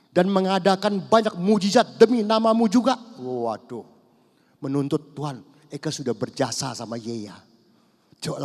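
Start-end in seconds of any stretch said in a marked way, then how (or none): 0.1-3.0 high, loud protest of the self-righteous; whiny demanding preacher; indignant pastors. Retold or imitated. (pendeta menuntut dengan suara tinggi)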